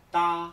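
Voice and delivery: TA loud, no puff of air; a firm, held first-tone TA without breath after the t; unaspirated Spanish t. Shown as a demonstration. Teacher shows what T A is not, unaspirated TA. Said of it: "no será".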